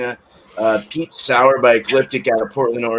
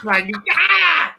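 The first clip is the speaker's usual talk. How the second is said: laughing yell. Retold or imitated. Loud laughing yell before grandma story.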